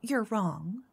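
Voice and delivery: normal talking voice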